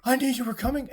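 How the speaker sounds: high, ominous knowing line; knowing lady. Acted. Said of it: "and she's like".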